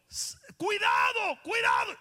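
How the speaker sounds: high, loud, slow cuidado; shouting a warning over live wires; urgent warning shout. Retold or imitated. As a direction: call out the danger. Retold warning about live cables.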